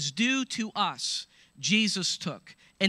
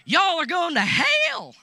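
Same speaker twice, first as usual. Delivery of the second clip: shouted slow hellfire preacher voice; mock angry preacher shouting damnation; 10 semitones higher, 7 dB louder, slower than usual. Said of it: "he's like".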